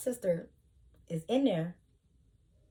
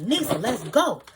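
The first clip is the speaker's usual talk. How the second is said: calling her sister out of the fight, louder; urgent shout; 4 semitones higher, 7 dB louder than usual. Retold or imitated. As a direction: pull her away with your voice. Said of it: "So I'm like".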